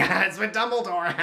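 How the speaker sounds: mock villain laugh around the line. Reviewer imitating Grindelwald laughing in his cell, mock laugh. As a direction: cackle it out as the gloating prisoner. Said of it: "he's just laughing like".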